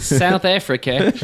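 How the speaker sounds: a hint of a South African accent, drawn out slowly; trying out a South African accent on the word. Podcast host practising a South African accent for friends, lightly accented. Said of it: "I'm working on my South African".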